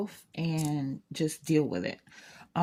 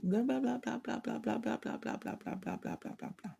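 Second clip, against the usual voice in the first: mock rambling blah; 6 semitones higher, 8 dB quieter than usual. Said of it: "I was like".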